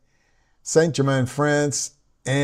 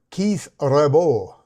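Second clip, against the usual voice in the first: put-on slow, drawn-out French-accented name; hint of a mock French accent; French-style pronunciation; much slower than usual. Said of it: "it sounds a little France".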